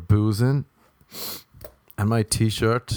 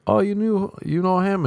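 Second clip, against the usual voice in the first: higher, puzzled question; surprised question; 10 semitones higher than usual. Retold or imitated. Friends asking the dude about the stranger, surprised. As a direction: sound caught off guard.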